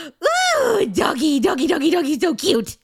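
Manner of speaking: high, loud mock squeal over a dog; squealed retelling, loud and high-pitched; excited zombie gushing. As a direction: imitate someone gushing wildly at a puppy. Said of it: "she got all excited".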